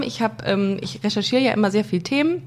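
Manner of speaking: ordinary talk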